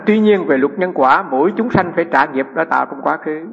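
measured, projected recitation from the page; formal reading. Acted. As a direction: read the passage out deliberately to the room. Reads teaching from the text.

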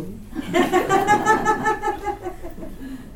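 high, drawn-out laughing fit; helpless high giggling; long laugh. Real, not acted. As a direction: burst out laughing at your own punchline. Laughs at own punchline.

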